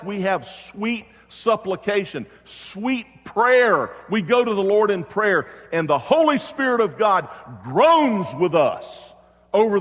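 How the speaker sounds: high, loud pulpit voice; full-force preaching. Real, not acted. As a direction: preach it out to the whole congregation.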